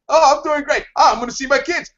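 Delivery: imitating a coworker thrilled for Friday; mock enthusiastic. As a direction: play up the joy of the coming weekend. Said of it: "They're all enthusiastic".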